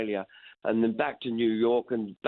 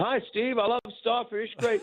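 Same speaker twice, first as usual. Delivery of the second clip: cheery fan; 13 semitones higher than usual. Retold or imitated. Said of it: "and people going".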